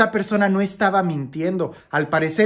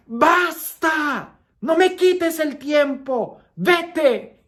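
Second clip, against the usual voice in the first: high, drawn-out angry shout imitating Padre Pío; berteriak menghardik; 10 semitones higher, much slower than usual. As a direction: shout it like a confessor throwing someone out. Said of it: "él gritaba".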